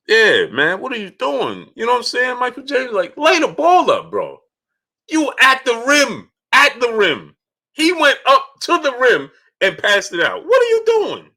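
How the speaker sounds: exasperated fan, voice shooting very high; real emotional outburst. Real, not acted. Fan venting to Michael James about the pass, emotional outburst. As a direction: rant in high-pitched disbelief at the play. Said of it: "I get emotional about".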